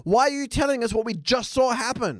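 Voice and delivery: high, incredulous ranting; mock exasperation. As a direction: rant at the screen in disbelief. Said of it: "And I'm like".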